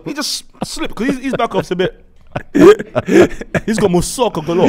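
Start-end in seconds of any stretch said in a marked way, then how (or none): 2.3-3.6 high burst of laughter; cracking up loudly. Real, not acted. Laughing at the story.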